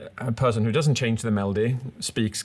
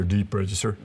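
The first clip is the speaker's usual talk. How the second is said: "deep register" said down low; 3 semitones lower than usual. Shown as a demonstration. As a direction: voice dropped a little low. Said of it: "So a register".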